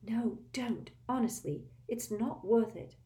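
urgent breathy whisper. A narrator's reading. Hermione whispering to Harry and Ron, urgent. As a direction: hush them, pleading.

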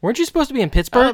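high, fast, startled; surprised question. Retold or imitated. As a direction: blurt it out in surprise. Surprised question, retold scene.

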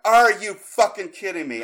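high, loud outraged shout; exasperated full-voiced rant; retold furious yell. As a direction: yell in comic disbelief and anger. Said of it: "I was so pissed".

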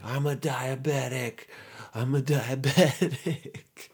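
high, drawn-out mock plea; mock pleading excuse. Suspect explaining the syringe to cops, mock pleading excuse. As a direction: stretch out the hammed-up alibi, breaking into a laugh.

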